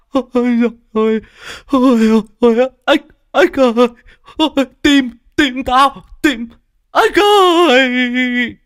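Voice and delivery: high, loud mock lament over the frog; old man wailing. Tearful old teacher wails over his dead frog. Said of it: "lệ muốn rơi đầy mặt".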